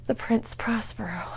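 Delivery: hushed whisper after the death. A narrator's reading. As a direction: breathe it like a secret.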